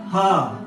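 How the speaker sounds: "हाँ" as a long "huh" for English "her", no R. Shown as a demonstration. Teacher showing how "her" sounds, long open vowel. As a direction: draw out the vowel of "हाँ", leaving the R off. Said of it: "उस अ को थोड़ा लंबा खींचना है".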